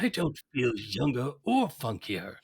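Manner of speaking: slow, drawn-out cartoon tycoon; Burns. Co-host imitating the boss fed beer like medicine, slow impression. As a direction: draw out each word as the old boss. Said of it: "He's like".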